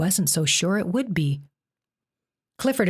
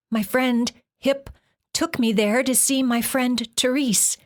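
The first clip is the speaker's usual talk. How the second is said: Jen's voice; 7 semitones higher than usual. A narrator's reading. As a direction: answer timidly in a girl's voice. Jen answering the monster.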